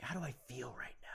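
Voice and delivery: soft breathy whisper to yourself. Acted. Host guiding listeners inward, whispered. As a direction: ask it inwardly, barely voiced.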